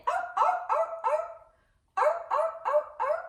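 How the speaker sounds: very high, loud barking arr; seal barking. Acted. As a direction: bark like a playful seal. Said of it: "the seal was barking at it".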